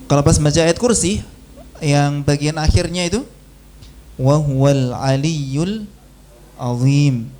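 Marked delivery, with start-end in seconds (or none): 4.2-7.2 slow, heavy "azim"; Quran recitation. Shown as a demonstration. Teacher recites the end of Ayat Kursi for students. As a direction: recite "wa huwal aliyyul azim" slowly with a thick dh. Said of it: "Itu dha, maka suaranya tebal".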